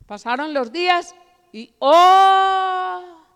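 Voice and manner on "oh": long, loud, high held "oh"; dramatic "oh". Acted. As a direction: hold a staged exclamation of dread at the turn. Dramatic turn in the story. (un "oh" alargado, alto y teatral)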